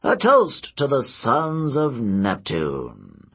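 put-on slow, ceremonious old man; slow mock toast. Old seer toasts Percy, slow and sly.

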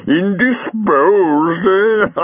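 high, drawn-out laughing echo; amused, voice way up high; chuckling. Acted. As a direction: repeat it slowly, squeaking with mirth. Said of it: "Jago chuckled".

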